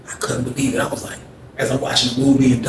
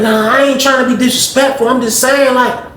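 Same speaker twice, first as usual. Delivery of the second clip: much higher, pouty complaint; Greg's high whiny voice; 11 semitones higher, 3 dB louder than usual. Retold or imitated. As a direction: whine high, slowing on cooties. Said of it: "He's like".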